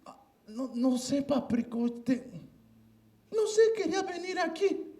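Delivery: put-on very high, timid child's voice; a little kid making excuses; shy scared boy. Scared child at father's bed. (niño tímido y asustado)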